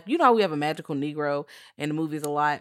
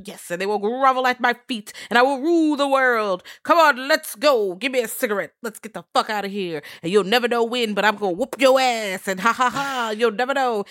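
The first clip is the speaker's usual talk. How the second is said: higher, louder mad villain rant; megalomaniac rant; 7 semitones higher, 6 dB louder than usual. Retold or imitated. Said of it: "he's just like".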